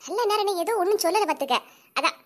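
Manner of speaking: usual speaking voice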